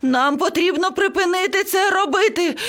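very high, panicked parrot; frantic high squawking voice; screeching. A narrator's reading. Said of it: "зарепетував папуга".

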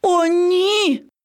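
high, dismayed cry; alarmed gasp. A narrator's reading. Lion cub recoils from the frowning parrot, alarmed.

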